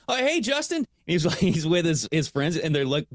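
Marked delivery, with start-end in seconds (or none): 0.1-0.9 high bright mock greeting; high cheery hello. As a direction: chirp the wrong name cheerfully. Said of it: "I'm like".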